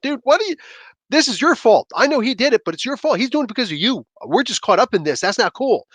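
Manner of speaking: high-pitched, indignant complaining; pissed-off residents. Acted. Residents blaming the heroes for their trouble, pissed off. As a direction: protest in a high, aggrieved voice. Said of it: "all the residents on there were pissed off".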